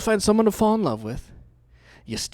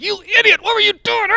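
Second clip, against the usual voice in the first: much higher, louder mock scolding; body cursing; 8 dB louder than usual. Body cursing him in the bathroom, high mock yell. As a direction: yell at yourself like an angry body. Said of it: "my body was cursing at me".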